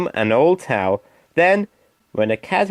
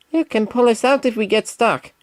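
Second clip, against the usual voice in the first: Percy voice; 8 semitones higher than usual. Acted.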